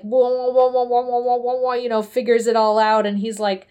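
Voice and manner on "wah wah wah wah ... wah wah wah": rapid repeated wah-wah noise; mind-meld sound effect. Retold or imitated. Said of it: "then he mind melds, like".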